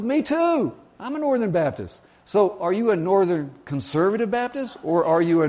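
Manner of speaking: very high, gleeful outburst easing down; hammed-up high-pitched delight; delighted agreement. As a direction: overplay the joy of common ground. Joke character's delight grows.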